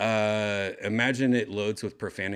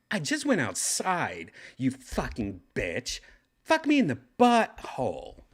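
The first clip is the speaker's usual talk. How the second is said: crude mock kid voice; hammed-up foul-mouthed kid, higher; TikTok kid voice; 4 semitones higher, 3 dB louder than usual. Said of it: "I guess, TikTok level".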